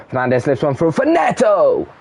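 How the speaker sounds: rising with the goal; excited commentary. Real, not acted. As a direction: lift your voice as the goal goes in. Goal scored, commentator rises.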